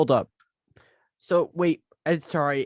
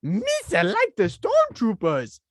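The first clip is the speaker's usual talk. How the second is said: very high, slow cartoon alien; Jar Jar voice; 9 semitones higher, 4 dB louder, slower than usual. Co-host sets up the joke in Jar Jar's very high voice. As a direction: squeak it out high and drawn. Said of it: "Instead of going".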